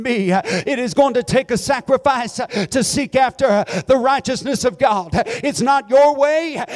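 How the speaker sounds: stirring Pentecostal preaching; preaching at full force. Acted. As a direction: build the sermon to a fervent peak.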